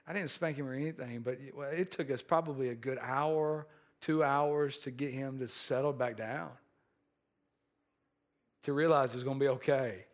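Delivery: ordinary talk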